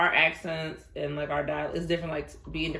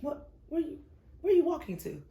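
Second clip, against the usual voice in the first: retold surprised call; 8 semitones higher than usual. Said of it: "I see her walking".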